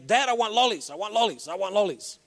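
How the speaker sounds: put-on nagging little boy asking for lollies; whiny kid. Son whining to his dad in the supermarket for lollies. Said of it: "the son began to say".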